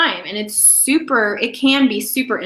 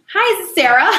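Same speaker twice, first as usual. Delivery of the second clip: high, louder, upbeat greeting; cheery phone hello; confident caller; 8 semitones higher, 5 dB louder than usual. Shown as a demonstration. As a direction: open the call brightly, pitched up. Demonstrates the polished phone greeting.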